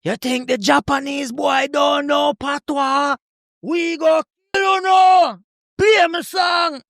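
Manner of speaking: high, slow, shouted Jamaican sound-system hype; dancehall DJ clash shout. Retold or imitated. Japanese DJ taunting rivals in a clash, shouting high and slow. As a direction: bellow it at the rival crew on the mic. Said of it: "they would go on the microphone and say".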